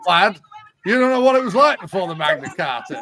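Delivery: loud, hammed-up Northern English voice; mock Northern English old-timer, amused and forceful; English Northern accent. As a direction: put on a broad North of England accent. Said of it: "either an English Northern accent".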